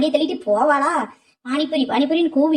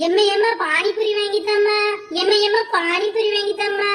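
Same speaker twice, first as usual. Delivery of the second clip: put-on high little girl begging; child nagging mother; childish pleading; 6 semitones higher than usual. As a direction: whine for a treat.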